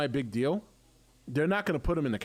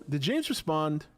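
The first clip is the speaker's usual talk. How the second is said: ordinary talk, nothing marked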